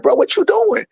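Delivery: high, startled challenge; mock shocked bro; surprised confrontation. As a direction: call him out in disbelief. Confronting the friend, retold.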